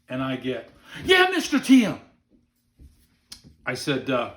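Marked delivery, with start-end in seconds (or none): 1.0-2.0 high, loud mock child's reply; eager little boy. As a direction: pipe up like an excited kid. Retold child eagerly answering guide.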